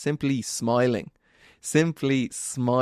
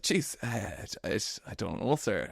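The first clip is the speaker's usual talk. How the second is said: softer, hesitant mumble; sheepish; 8 dB quieter than usual. Retold or imitated. Young [name] answering his teacher, sheepish and softer. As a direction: stammer it like a caught pupil. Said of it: "And I was like".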